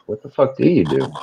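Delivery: ordinary delivery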